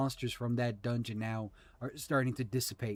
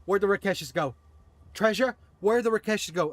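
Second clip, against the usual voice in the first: higher, louder brash rider calling out; loud demanding npc; 6 semitones higher, 7 dB louder, faster than usual. Acted. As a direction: bellow the question across the field. Horse-man rider demands the monsters.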